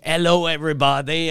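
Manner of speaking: hammed-up French Canadian English; thick Quebec accent. Imitating Alan from Minnesota on French TV, thick Quebec accent. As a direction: greet the audience in a mock thick Quebec accent. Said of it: "with a thick Quebec accent".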